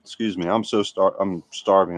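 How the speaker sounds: talking with a mouth full of food; chewing between words. Real, not acted. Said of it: "That's the only reason I'm stuffing my face".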